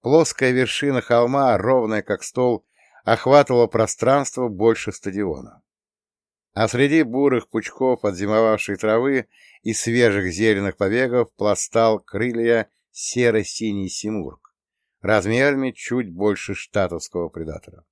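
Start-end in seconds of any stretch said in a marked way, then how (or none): none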